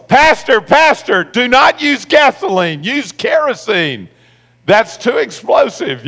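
high, urgent yell mimicking a panicked man; imitated frantic yell. Man yells to stop the pastor using gasoline, mock frantic yell. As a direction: holler a warning in a high imitated voice. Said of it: "he yells".

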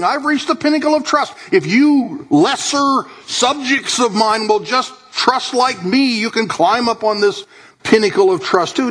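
a hint of a pompous boast, slowed on the promise; mock boastful king. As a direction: lightly mimic a smug king lording it over his subjects. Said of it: "banging himself on the chest".

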